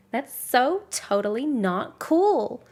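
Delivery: slow, loud, high mock American Valley Girl accent. Shown as a demonstration. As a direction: stretch it out like an overdramatic American teen girl. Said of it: "the overdramatic".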